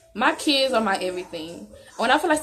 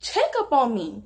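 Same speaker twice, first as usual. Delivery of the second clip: pleading for affection; 5 semitones higher than usual. Retold or imitated. Girlfriend imagining telling boyfriend what she was feeling, pleading and high. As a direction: beg lovingly, pitch lifted. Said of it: "That's what I was feeling".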